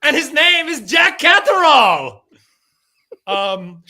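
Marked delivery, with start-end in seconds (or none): 0.0-2.2 loud, high hype-man intro building to a shout; ring announcer call. Acted. Podcaster hyping the new George Kambosos to his cohost, shouting announcer style.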